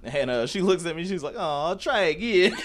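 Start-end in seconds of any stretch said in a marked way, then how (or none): 1.4-2.5 mock taunting. Opponent's taunt quoted.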